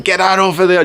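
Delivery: high-pitched mock police order; storming policewoman. Policewoman storming up to the rider, mock shouted order. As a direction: imitate an officer storming up and ordering. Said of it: "she comes storming up".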